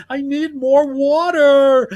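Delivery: put-on high, loud childish whine; Stinky's whiny voice. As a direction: play a grown man acting like a bratty kid. Imitating Stinky again.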